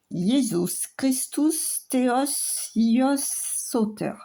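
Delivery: slow, careful Greek words with an unsure accent; attempted Greek accent. Shown as a demonstration. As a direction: sound out the Greek phrase slowly, unsure of the accent. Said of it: "Mon accent doit pas être au top". (prononciation grecque hésitante, lente et appliquée)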